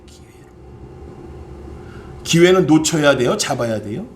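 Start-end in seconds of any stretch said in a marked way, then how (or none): 0.0-0.5 a breathy stage whisper for emphasis; hushed repeat of the key word; whispered echo. Acted. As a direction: whisper the word back to the congregation. Preacher echoes key word.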